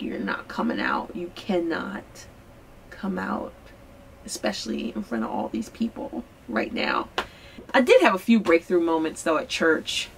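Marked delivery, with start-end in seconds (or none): 0.0-7.1 whispered inner scolding to her own feelings. Retold or imitated. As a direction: hiss it under your breath, keep them locked in. Telling her emotions to stay hidden.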